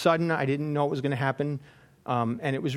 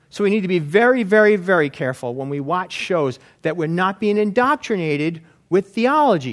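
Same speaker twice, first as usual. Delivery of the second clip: ordinary talk, nothing marked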